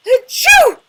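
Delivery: big loud hammed-up sneeze; a huge cartoon achoo. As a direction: dragon sneezes at the feathers, very loud and high. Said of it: "make me sneezy".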